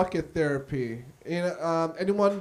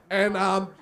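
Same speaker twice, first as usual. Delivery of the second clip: ordinary talk, nothing marked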